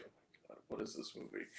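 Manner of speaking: very low, hushed aside; muttered disbelief. Retold or imitated. Moviegoer reacting to the same song, muttered in disbelief. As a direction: mutter it far under your breath.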